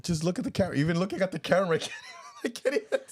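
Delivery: high, loud protest dissolving into laughter; helpless high-pitched laughing exasperation; flustered laughing. Real, not acted. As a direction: plead through the giggles, pitch climbing. Flustered, giggling at being looked at.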